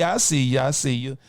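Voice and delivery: ordinary delivery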